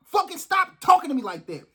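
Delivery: high, heated command to stop; angry order. Real, not acted. Fed up with people talking down to him, angry order. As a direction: snap it out in anger. Angry rant before apologizing.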